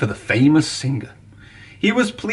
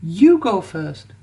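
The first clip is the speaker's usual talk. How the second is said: slow gentle offer; a slow, gentle engine letting his friend go; kind offer; 5 semitones higher, slower than usual. A narrator's reading. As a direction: let your friend go first. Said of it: "peeped Thomas".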